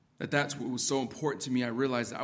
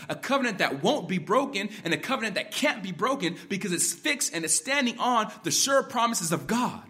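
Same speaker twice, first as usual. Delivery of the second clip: raised, louder preaching voice; impassioned exhortation; 5 semitones higher, 5 dB louder than usual. Acted. Preacher voicing the psalmist's call to Israel, impassioned. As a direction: press each promise home with force.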